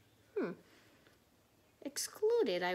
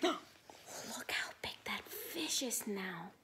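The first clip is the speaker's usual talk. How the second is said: slow whispered wonder; breathless awe, softly and slowly; awed whisper; 5 dB quieter, slower than usual. Real, not acted. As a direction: hush the class in amazement. Teacher marvels at the picture.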